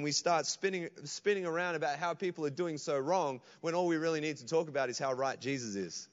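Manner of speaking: ordinary talk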